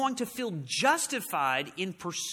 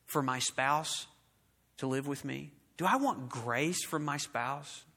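soft humble question; 5 semitones lower, 6 dB quieter than usual. Acted. Preacher voicing a humble spouse's inner question, softly. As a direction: ask it gently, as a humble spouse.

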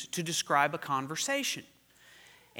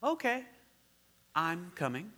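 murmured; 6 dB quieter than usual. Acted. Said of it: "I murmured".

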